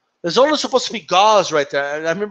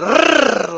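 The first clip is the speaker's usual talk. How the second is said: long trilled "rrr", higher pitched; rolled R noise; 5 semitones higher, 3 dB louder than usual. Retold or imitated. Showing how the boxer rolls his R's, long trilled R. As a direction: roll out a loud tongue trill. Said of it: "started rolling those R's".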